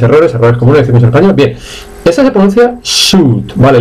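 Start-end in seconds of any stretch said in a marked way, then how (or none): 2.8-3.5 "suit" as long "sut", no I sound; silent I. Shown as a demonstration. Teacher models it for learners, "suit" with silent I. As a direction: draw out "suit" as "suuut". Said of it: "La I no suena".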